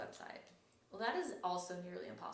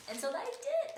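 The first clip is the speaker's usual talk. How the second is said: triumphant; 7 dB louder than usual. Real, not acted. Triumphant payoff of the story.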